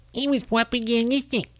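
high pinched mocking voice; ridiculing a talk-radio host's voice; mock Mark Levin. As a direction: squeeze it through your nose, high up. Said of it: "Mark Levin talks like this".

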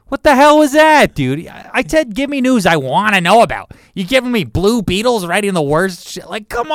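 high, very loud mock outrage; exasperated outburst. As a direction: shout it in exasperated disbelief at your co-host. Mock outrage at bad news.

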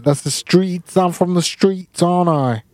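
lightly put-on London street accent; a hint of a London street accent. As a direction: lean into a cheeky London street accent, mate. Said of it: "I'll give you an accent".

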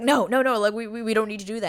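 loud, high, rapid refusal; flustered protest. Acted. Anthony refusing to size the ring on Kate, flustered.

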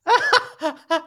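sudden high, loud burst of laughter; real laughter at the memory; big laugh. Real, not acted. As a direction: crack up, way up high. Laughing at his own story.

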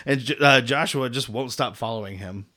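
ordinary talk, nothing marked

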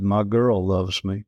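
ordinary talk, nothing marked

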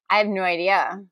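ordinary talk

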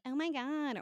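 high-pitched valley girl impression. Host showing a dialect unlike her own, valley girl. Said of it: "valley girl quality".

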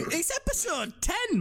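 high, drawn-out excitement; excited. Real, not acted. Host celebrating the tenth episode, excited. Excited at reaching double figures.

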